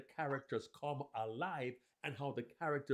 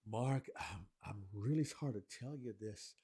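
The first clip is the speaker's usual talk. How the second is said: a hint of a lower, hesitant father; reluctant dad; 5 semitones lower, 6 dB quieter than usual. Shown as a demonstration. Dad reluctant to tell son bad news, slightly lower. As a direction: hold back, he doesn't want to say it. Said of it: "you can hear that he doesn't wanna share".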